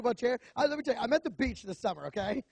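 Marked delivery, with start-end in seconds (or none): none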